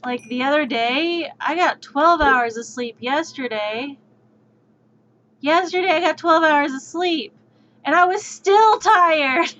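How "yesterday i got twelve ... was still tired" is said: exasperated. Real, not acted. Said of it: "exasperated about sleep".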